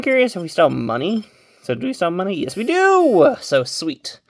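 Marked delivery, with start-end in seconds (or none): 2.3-3.4 much higher, louder, slow happy cheer; drawn-out, high, delighted cry; excited yes. Real, not acted. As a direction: cheer at the good news. Glad to still have money.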